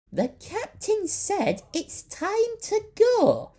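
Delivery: very high, slow called-out voice; calling out. A narrator's reading. Walking Stick calling to the transfixed crew, high and slow. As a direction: call across the room to them. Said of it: "she called".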